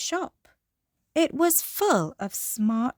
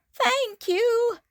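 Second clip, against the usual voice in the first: goat voice; 13 semitones higher, 3 dB louder than usual. Acted. Goat thanks George.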